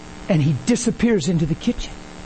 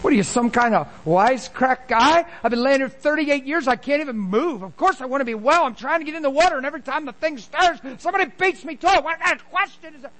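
indignant, pitched well up, mocking the sick man; offended wisecrack retort; 8 semitones higher than usual. Preacher voicing the offended man at the pool, indignant. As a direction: huff it out like a man insulted by the question. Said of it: "You think the guy would have said".

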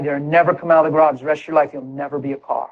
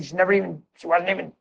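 ordinary delivery

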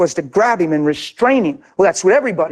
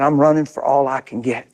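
usual speaking voice

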